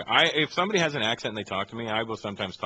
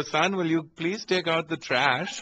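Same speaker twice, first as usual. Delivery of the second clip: slow, put-on auntie voice, higher; imitating an Indian aunt; 8 semitones higher, 3 dB louder, slower than usual. Imitating the caller as one of his aunts asking him to take out the trash. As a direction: nag like a relative asking for a chore. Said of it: "I was expecting her to go".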